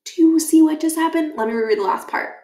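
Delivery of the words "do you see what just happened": breathy awed whisper; excited whisper. Real, not acted. Reader turning to the listener, awed whisper. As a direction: whisper it in disbelief. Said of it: "reader's excited whisper".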